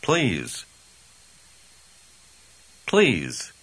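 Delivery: ordinary talk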